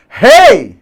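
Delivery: Hey! yelled very high and loud; shouted at the gate. Shown as a demonstration. Teacher yelling at a gate to find the stressed vowel, loud high shout. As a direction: call Hey! across the gate as loud as you can. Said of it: "Grita no portão".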